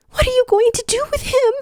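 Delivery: high, loud, fast panicked question; frantic plea. A narrator's reading. Tikal pleading with the clan member, frantic high cry. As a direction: demand it in panic.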